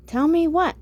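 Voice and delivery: high, insistent demand. A narrator's reading. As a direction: demand to be told. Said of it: "I demanded".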